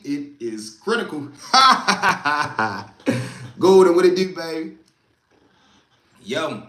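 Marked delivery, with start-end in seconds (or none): 1.3-3.5 big loud burst of laughter; hearty laugh. Real, not acted. Laughing after mock lecture. 3.6-4.8 loud, hyped greeting; loud hello to Golden. Real, not acted. Greeting co-host loudly.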